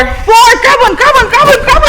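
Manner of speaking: high, loud rallying yell; excited shouting. Real, not acted. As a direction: shout them into the scramble. Game call, everyone grabs.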